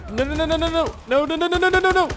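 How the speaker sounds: rapid-fire high, loud pleading; panicked string of high-pitched noes. Real, not acted. Player stopping an in-game killing, panicked.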